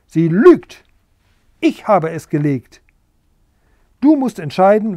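loud, shrieking hen's outburst; squawking. Acted. Hen Bertha squawking her defence to the king, loud. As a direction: bawl it at the rival hen. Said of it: "Bertha krakeelte".

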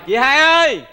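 high, loud call for the host; drawn-out loud hail. Acted. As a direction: holler for the lady of the house, stretching the last word. Gọi chủ nhà từ ngoài.

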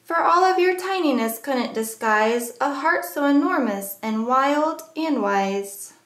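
usual speaking voice

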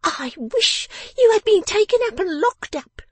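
high, loud tirade; angry scolding. A narrator's reading. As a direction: wish punishment on him in a high, furious voice. Miss Wren scolding her father.

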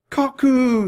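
very high, loud, held scream; piercing cry of the name. A narrator's reading. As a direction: scream the name after a lost friend. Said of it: "screamed Annie".

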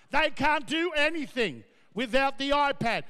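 normal talking voice

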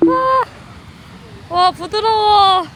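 high, loud awed wow; amazed. Real, not acted. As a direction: gasp at the view, slow. First sight of the sea.

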